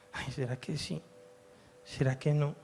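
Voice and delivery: soft, hesitant wavering with sighing "ay"; doubting inner voice. Retold or imitated. Pastor voicing his own doubting voice, soft and unsure. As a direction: dither quietly between yes and no. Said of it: "¿cómo sé yo cuál es mi voz?".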